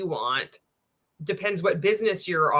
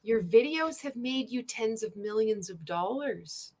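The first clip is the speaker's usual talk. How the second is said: slow, softer disbelieving repeat; sarcastic echo; 3 semitones higher, 5 dB quieter than usual. Retold or imitated. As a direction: drag it out to mock his claim. Sarcastic repeat of his boast.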